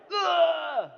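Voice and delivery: loud, high mummy groan; dusty mummy rasp. Pretend. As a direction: let out a loud mummy moan. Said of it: "You wanna sound like a mummy".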